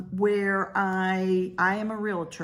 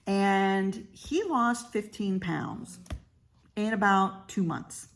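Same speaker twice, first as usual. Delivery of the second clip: usual speaking voice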